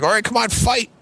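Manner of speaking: high-pitched mock goading; crowd egging on. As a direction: imitate impatient kids demanding a fight. Imitating crowd urging fight.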